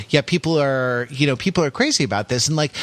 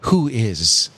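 radio voice; 5 semitones lower, slower than usual. Pretend. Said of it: "figure out a radio voice".

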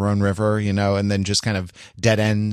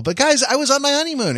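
excited announcement; 13 semitones higher, faster than usual. Real, not acted.

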